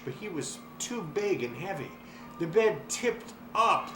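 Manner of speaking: ordinary delivery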